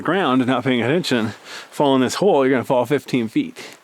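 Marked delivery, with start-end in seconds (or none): none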